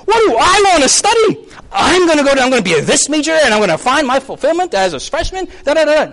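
high, gushing student excitement; hammed-up eager newcomer; excited freshman. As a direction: burst out with big plans. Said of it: "you're like".

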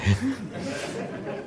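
a quiet high chuckle; soft laugh. Real, not acted. Preacher chuckles at his own punchline, soft and quiet. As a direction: laugh softly under the breath.

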